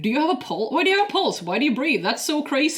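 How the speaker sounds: hammed-up curiosity, question after question; imitated amazement. Imitating Bashir quizzing Data about his body, amazed.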